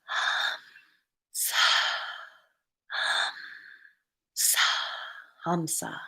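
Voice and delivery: soft whispered syllables, spaced with the breath; breathed mantra. Shown as a demonstration. Reader guiding listeners to follow their breath, whispering on it. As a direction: sound "hum" on the in-breath and "sa" on the out-breath. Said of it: "Become aware of the breath coming in".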